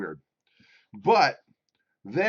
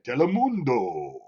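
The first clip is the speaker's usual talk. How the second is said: low Spanish announcer; 2 semitones lower than usual. Acted. Finally remembering the channel, Spanish announcer accent. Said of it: "you gotta get low".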